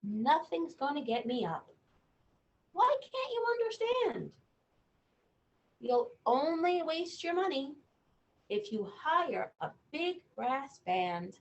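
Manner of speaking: lightly voiced stubborn boy reading; reading to children. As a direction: read the boy's refusal to a child. Reads picture book aloud.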